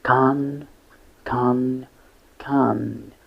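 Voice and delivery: "con" drawn out and repeated alone; "con", a separate syllable held for learners; isolated syllable demo. Shown as a demonstration. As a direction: sound out "con" slowly with gaps between. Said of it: "it is pronounced".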